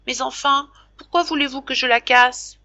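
put-on high, pleading boy's voice near tears; tearful plea. Jacques pleading with his father, tearful high voice. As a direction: beg to be trusted, close to crying. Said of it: "la voix éplorée de Jacques".

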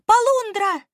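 high, loud delighted call; loud cry. A narrator's reading. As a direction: cry out at the top of your voice. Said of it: "громко закричала". (громкий, высокий крик)

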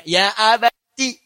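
slow, drawn-out loving call; tender address. Shown as a demonstration. Son answering his father with tender respect. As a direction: stretch it out with respect and love. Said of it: "Lihat cara menerjemahkannya".

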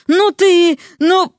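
sputter, lost for words; indignant sputter. A narrator's reading. Vasya speechless at her husband, indignant.

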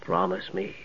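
breathy whisper from a dream; whispered echo. A narrator's reading. Sturm's remembered plea whispered to Tanis. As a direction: barely voice it, haunting. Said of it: "softly spoken words".

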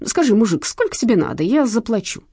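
mildly lamenting thief; wailing plea. A narrator's reading. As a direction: beg him to take the money. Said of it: "причитал карманник".

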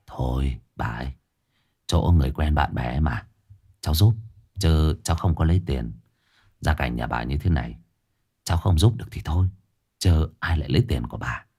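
voix d'homme un peu plus grave, posée, qui refuse poliment; voix grave de Dương Đông. Acted. Dương Đông nói với bà cụ.

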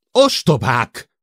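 loud high yell, stretched out; drawn-out furious shout; bellowed insult. A narrator's reading. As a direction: roar it at the whole group in fury. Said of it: "ordította".

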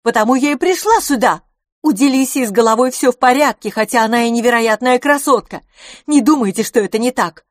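high, loud, emphatic; brash Miss Dubarry. A narrator's reading. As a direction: argue forcefully in a high voice. Miss Dubarry speaks in story. (напористо, громко и высоко)

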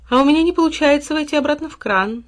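high, plaintive mock weeping; crying. A narrator's reading. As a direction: whine it like a weeping character. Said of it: "заплакала вода". (плачущий голос)

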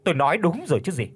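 high, fast gloating scold; triumphant insults. Acted. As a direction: crow over her while insulting. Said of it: "đắc thắng thì xỉ vả". (mẹ chồng đắc thắng xỉ vả, giọng cao)

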